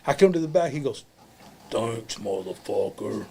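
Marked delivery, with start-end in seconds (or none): 1.7-3.3 low, slow, seething thanks; mad. Retold or imitated. Wrestler quotes his colleague back after the match, mad. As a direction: imitate him being mad, dragging the words out low. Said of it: "He's mad".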